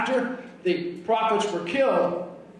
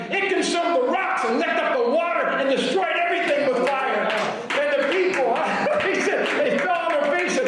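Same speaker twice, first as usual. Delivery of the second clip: ordinary talk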